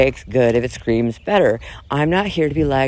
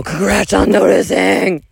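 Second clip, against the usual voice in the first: raised mock-yelling sarcasm; faux yell; 5 semitones higher, 4 dB louder than usual. Taunting critics who call her unwell, faux yell. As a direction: fling it back with a pitched-up fake yell. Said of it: "faux yell".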